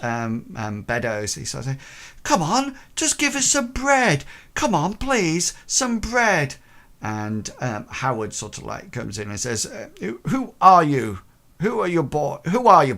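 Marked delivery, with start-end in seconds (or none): 2.2-6.5 put-on high pleading boy's voice; begging boy. Boy begging housekeeper for bread. 10.0-12.9 stern master. Acted. Howard challenging the intruder.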